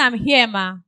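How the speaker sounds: loud, impatient reply. Retold or imitated. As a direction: snap back loudly. Angry caller quoted, louder.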